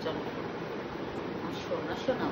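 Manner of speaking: soft low sweetheart call; tender lulling. Real, not acted. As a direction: lull her to sleep. Lulling the child to sleep.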